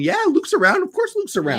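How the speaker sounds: very high, sing-song of course; high mock voice. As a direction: squeak it like it's obvious. Mock obvious answer.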